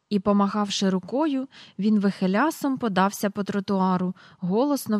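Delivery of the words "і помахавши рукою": usual speaking voice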